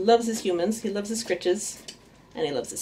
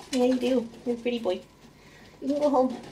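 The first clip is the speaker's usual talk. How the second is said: higher, sweet voice for a pet; pet talk; 4 semitones higher, 4 dB quieter, faster than usual. Real, not acted. Owner sending her rat home, sweet pet voice. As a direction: coo to the little rat.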